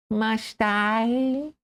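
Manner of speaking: sheepish high mouse voice; embarrassed little mouse, pitched high; abashed mouse. Acted. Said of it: "an abashed".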